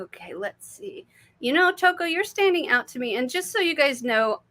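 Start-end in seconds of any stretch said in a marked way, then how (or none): 0.0-1.1 whisper. Real, not acted. Settling into the next reading.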